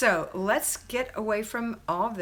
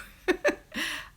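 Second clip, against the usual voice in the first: self-conscious laugh; 9 semitones higher, 4 dB louder than usual. Real, not acted. Laughs at her own French.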